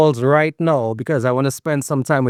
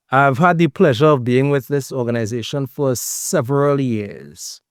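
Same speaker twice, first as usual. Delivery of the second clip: normal talking voice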